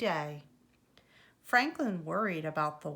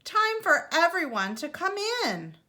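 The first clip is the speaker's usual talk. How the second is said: higher, louder call to come inside; 9 semitones higher, 6 dB louder than usual. A narrator's reading. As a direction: summon the kids home. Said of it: "called his mother".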